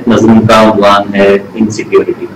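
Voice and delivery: ordinary delivery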